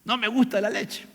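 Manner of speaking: put-on high-pitched childish complaint; acted kid objecting to food; questioning little child. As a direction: whine to mum like a small child. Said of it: "Ese niño se pone a cuestionar".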